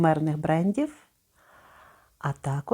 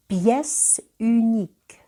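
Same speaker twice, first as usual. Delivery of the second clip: Pièce unique slow, higher; slow French model; 4 semitones higher, 3 dB louder, slower than usual. Shown as a demonstration. Teacher first reading the perfume name. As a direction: say Pièce unique slowly and clearly. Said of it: "Спочатку читаємо окремо кожну частину".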